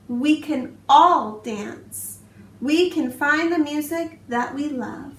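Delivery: put-on expressive read-aloud for young children; storybook narration. A narrator's reading. Teacher reading a picture book to her class, expressive storytelling. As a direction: tell it like bedtime story time, rhythmic and lively.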